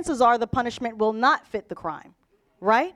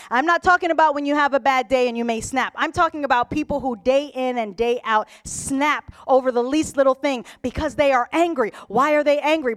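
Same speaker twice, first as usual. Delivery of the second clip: ordinary delivery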